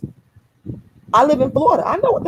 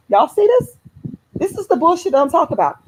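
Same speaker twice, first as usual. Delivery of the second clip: outraged; 9 semitones higher than usual. Real, not acted. Outraged at a viewer comment.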